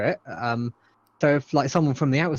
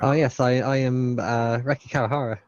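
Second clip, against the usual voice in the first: ordinary talk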